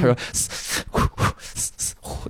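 unvoiced hiss and huff breaths; breathing demo. Shown as a demonstration. Performer doing the running breath on stage, unvoiced hissing. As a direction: imitate the running breath softly. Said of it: "他就这么，这么吸气".

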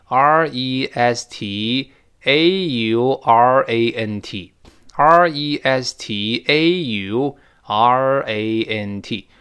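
very slow, one letter at a time; careful spelling out. Shown as a demonstration. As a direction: dictate each letter with space round it. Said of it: "注意拼写啊".

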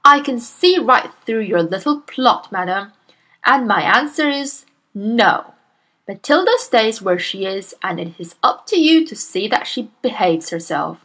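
loud, booming bully of a headmistress; Miss Trunchbull. Acted. Bullying headmistress scolds Miss Honey, booming and loud. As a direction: bark at the timid teacher like a tyrant.

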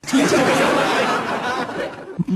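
airy falling giggle; wheezing high laugh; breathy high laugh. Real, not acted. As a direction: giggle and let it fall. Laughs at own joke.

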